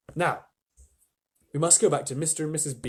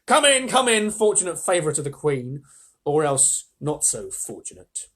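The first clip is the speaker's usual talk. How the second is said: a big menacing wolf ushering him in; wolf welcome; 6 dB louder than usual. Acted. Wolf greets Edmund at door.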